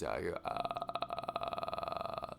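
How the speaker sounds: low, soft croaking "uh"; drawn-out vocal-fry croak; demonstrated croak. Shown as a demonstration. Sick vlogger showing viewers his croaky voice, croaking. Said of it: "Like, I could do this".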